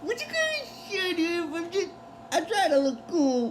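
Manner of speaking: imitating a peeved guy in a high, soft voice; high-pitched, held-back whine of complaint; mock annoyed. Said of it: "Fry gets a little annoyed".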